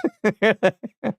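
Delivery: high-pitched giggle; a burst of high, quick giggles; laugh. Real, not acted. Streamer amused by the kneecaps joke, giggling.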